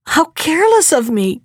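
a lightly acted gasp of false dismay. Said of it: "gasped she".

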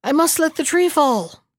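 loud, high strained shout. A narrator's reading. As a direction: call out under the weight.

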